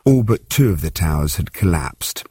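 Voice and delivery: ordinary delivery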